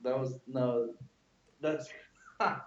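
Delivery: breathless giggly words; can barely talk for laughing; laughing too hard. Real, not acted. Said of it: "I can't even talk".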